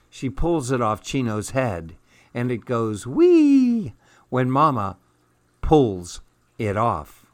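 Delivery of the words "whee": long, high, held squeal of glee; playful whee. A narrator's reading. Narrator voices Mama's scarf sliding off, playful high whee. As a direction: draw it out high and loud.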